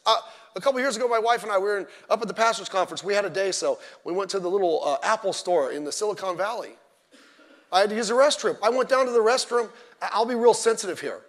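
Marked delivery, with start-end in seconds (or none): none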